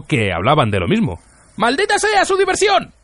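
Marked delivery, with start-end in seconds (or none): 1.6-2.9 very high-pitched, raging yell; furious howl. A narrator's reading. Arthur cursing as he runs from the tavern, furious howl. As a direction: howl the curse at the top of the pitch. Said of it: "aulló".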